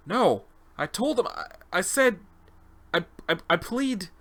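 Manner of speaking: high, broken-off pleading; stammering denial. Acted. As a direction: falter as you try to plead innocence. Accused subject stammers denial.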